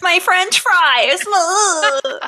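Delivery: high, slow fake-crying lament; hammed-up tearful whine; mock whimpering. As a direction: wail pitifully as if mourning food. Mock lament over robbed food.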